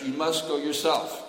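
loud, high plea with must stressed; pleading lament. Acted. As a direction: push the word must, grieving and urgent. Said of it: "And Uddhava was crying".

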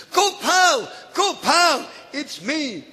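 high, loud eager call; loud, joyful calling of a name; calling out eagerly. Acted. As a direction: cry out to him like a child who found his friend. Boy calls out to Narayan.

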